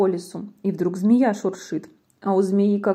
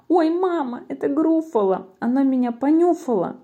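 high, alarmed little voice; frightened mouse; 5 semitones higher than usual. Acted. Mouse is sniffed by the Gruffalo, frightened squeak.

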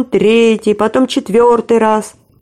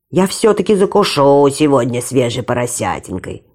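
wolf voice thinking; 7 semitones lower than usual. A narrator's reading. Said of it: "подумал волк".

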